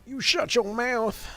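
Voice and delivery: high, slow snarl; mock character voice. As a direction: throw a mocking jab. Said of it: "kinda nasally voice for it".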